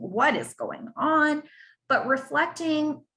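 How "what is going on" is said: slow, higher, baffled wondering; bewildered thought. Retold or imitated. Caregiver hearing spouse plan a France trip, slow baffled disbelief. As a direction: let the confusion stretch out.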